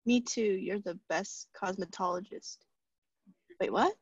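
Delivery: ordinary talk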